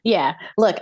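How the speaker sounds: ordinary talk, nothing marked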